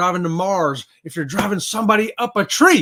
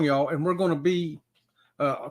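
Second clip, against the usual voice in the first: usual speaking voice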